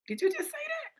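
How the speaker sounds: high, hushed disbelief; mock disbelief. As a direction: squeak it softly, as if taken aback. Acting startled reply to God.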